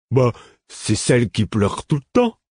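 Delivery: careless shrug of a line; a lightly voiced Lourd, dismissive; offhand. A narrator's reading. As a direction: toss it away, not bothered. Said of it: "d'un ton désinvolte".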